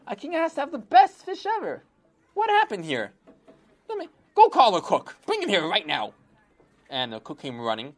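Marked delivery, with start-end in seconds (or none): 0.1-6.1 high, loud indignant king; loud high royal outrage over the fish; angry king. Acted. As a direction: demand the cook like an outraged king. King angry about stale fish.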